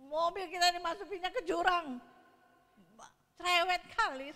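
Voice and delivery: put-on loud, high Medan-style teasing in a Medan accent. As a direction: bellow it the blunt Medan way. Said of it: "bahasa Medan saya". (logat Medan yang lantang dan keras)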